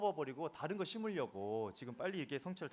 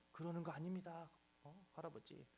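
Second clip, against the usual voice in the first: restrained protest; 6 semitones higher, 5 dB quieter than usual. Retold or imitated. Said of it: "막 그렇게 얘기를 하고 싶었지만".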